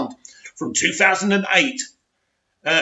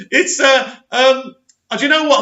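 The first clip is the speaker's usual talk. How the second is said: higher, louder spluttering; lost for words at the article; flustered amusement; 7 semitones higher, 5 dB louder than usual. Real, not acted. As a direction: stumble over words, laughing inside.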